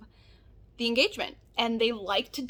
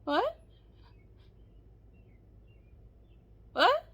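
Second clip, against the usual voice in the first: high rising disbelief; stunned what; 10 semitones higher than usual. Real, not acted. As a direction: react stunned. Stunned reaction.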